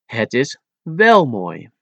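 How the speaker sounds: normal talking voice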